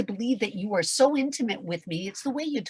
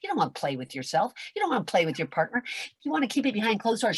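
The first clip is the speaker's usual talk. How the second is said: usual speaking voice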